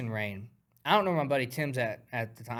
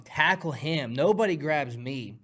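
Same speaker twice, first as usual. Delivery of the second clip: ordinary talk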